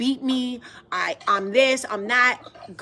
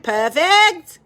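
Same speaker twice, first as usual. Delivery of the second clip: put-on playful Australian accent, higher and louder; mock Australian accent; 5 semitones higher, 7 dB louder than usual. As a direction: say "Perfect." like a hammed-up Aussie. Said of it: "As is my Australian accent".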